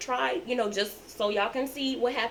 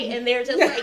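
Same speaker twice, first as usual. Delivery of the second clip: usual speaking voice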